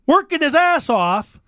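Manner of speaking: usual speaking voice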